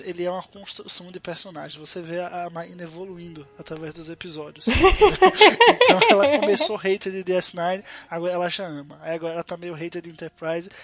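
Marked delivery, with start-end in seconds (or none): 4.7-5.8 high loud shrieking laugh. Real, not acted. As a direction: explode into a shrill laugh. Laughs teasing about co-host.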